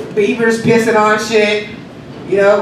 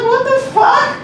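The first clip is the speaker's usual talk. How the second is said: squeaky high disbelief; shocked high what the fuck. Retold or imitated. Comic reacting to a naked photo money, high shocked. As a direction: throw it up high in outrage. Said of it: "I was like".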